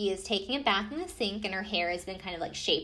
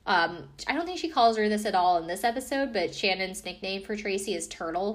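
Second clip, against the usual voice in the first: ordinary talk, nothing marked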